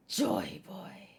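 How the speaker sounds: breathed villain whisper; menacing whisper. Acted. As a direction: hiss the name like a dark secret.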